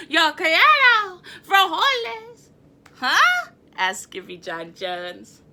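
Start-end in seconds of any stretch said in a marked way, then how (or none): 0.1-2.5 somber declaration. Acted. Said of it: "in his most somber voice declared". 3.0-3.5 surprised huh. Acted. Skippy surprised.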